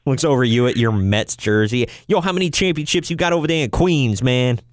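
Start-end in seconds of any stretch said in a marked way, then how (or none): 2.1-4.6 higher, fast taunting fan imitation; super Yankee fan. Host imitating a Yankee fan taunting a Mets jersey, cocky fan impression. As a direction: razz a rival fan, mock die-hard. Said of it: "the super Yankee fan".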